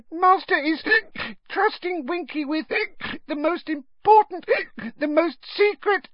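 very high, halting elf voice broken by hiccups; drunken little elf, proud and wounded; indignant squeak. A narrator's reading. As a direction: boast tipsily in a tiny squeak. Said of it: "Winky squeaked indignantly".

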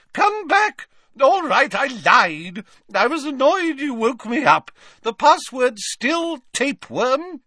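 high pleading portrait lady; Fat Lady calling after. Acted. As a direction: call after him, then sulk.

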